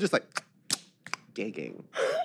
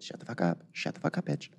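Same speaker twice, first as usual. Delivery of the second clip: mock angry hiss; 7 semitones lower, 7 dB quieter than usual. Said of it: "So you're angry?".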